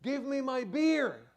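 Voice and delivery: loud, high, drawn-out yell; retold scream. Imam retelling a dying man's demand, screamed. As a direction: holler it out, high and slow. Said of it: "he screamed off the top of his lung".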